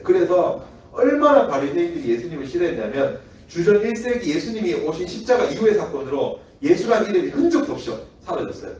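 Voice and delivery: usual speaking voice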